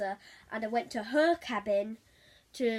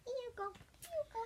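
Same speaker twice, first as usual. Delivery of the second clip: mock creepy voice; 12 semitones higher, 10 dB quieter than usual. Voicing the egg dude.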